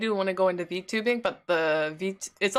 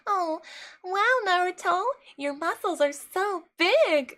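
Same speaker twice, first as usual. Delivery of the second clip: put-on high, slow, fawning anime girl; fake anime girl voice; 10 semitones higher, slower than usual. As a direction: swoon over the hero. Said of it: "it doesn't sound like that, like".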